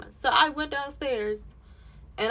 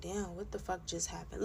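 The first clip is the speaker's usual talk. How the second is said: very soft, lower imitated disbelief; stunned aside; 5 semitones lower, 14 dB quieter than usual. Housemate left sitting after the row, imitated in stunned quiet. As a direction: say it under the breath, dumbfounded.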